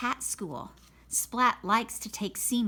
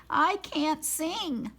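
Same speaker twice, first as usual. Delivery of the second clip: shy cat; 6 semitones higher, slower than usual. Acted.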